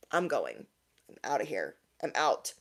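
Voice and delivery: low, hushed, done with it; quiet resolve. Retold or imitated. Retelling her decision to walk out on the ex, quiet resolve. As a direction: drop low and soft, like slipping away.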